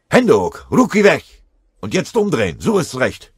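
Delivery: very loud, high barking orders; shouted commands. A narrator's reading. Hähnel forcing the Russian sniper to surrender, shouting. Soldier capturing enemy at gunpoint.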